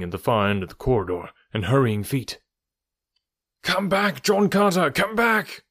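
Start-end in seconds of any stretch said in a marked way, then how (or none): 3.6-5.6 shouted warning. A narrator's reading. A rescuer calls John Carter back from the burning pits, high shout. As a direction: yell to call him back from the fire. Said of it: "cried a voice".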